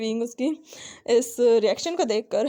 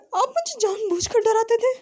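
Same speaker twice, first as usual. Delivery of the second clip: much higher, louder outraged question; indignant surprise; 10 semitones higher, 3 dB louder than usual. A narrator's reading. Student realising the professor scared him on purpose, high outraged disbelief. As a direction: squeak it in disbelief.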